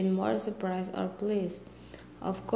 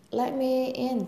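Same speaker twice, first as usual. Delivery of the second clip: pleading; 5 semitones higher, 4 dB louder than usual. A narrator's reading. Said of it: "the frog pleaded".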